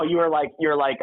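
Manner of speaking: usual speaking voice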